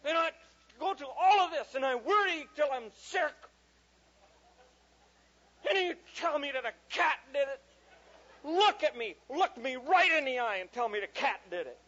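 high, loud, hammed-up parental tirade; retold outraged father yelling; furious dad. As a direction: build a worked-up guilt-trip rant. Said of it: "Oh, I was furious".

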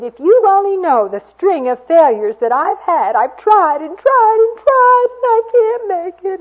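very high, loud, whining and tearful; whiny tearful voice. Retold or imitated. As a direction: whimper it out through tears. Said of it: "She had a real whiny voice".

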